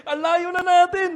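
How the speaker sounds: high, loud laughing protest; laughing retort. Retold or imitated. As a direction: laugh off the suggestion. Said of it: "Sabi ko".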